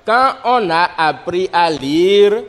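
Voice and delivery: slow, spaced dictation phrasing. Shown as a demonstration. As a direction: dictate slowly for pupils to write. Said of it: "passer à l'écriture de la dictée".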